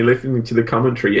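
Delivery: normal talking voice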